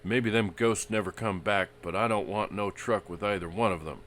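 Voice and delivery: sulky, grumbling refusal; sullen. Acted. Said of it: "His voice grew sullen".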